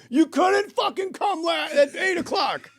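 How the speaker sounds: very high, loud shout up to a balcony; retold furious shout. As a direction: scream it slowly at the back of the house.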